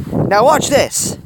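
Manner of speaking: high, loud, drawn-out call; excited. A narrator's reading. Woman about to show her experiment, excited. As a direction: draw it out with eager excitement.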